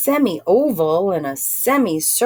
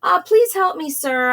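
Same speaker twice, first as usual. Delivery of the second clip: pleading; 8 semitones higher, 3 dB louder than usual. Acted. Narrator pleads with a stranger.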